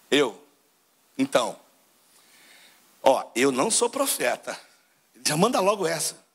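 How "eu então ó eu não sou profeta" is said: lower, slower John, humble and firm; voicing John the Baptist. Preacher acts out John answering the Levites, low and slow. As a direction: drop low and take it slowly, like a prophet. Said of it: "João fala assim".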